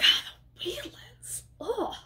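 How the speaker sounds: hint darkly at a bad reputation; ominous barfly.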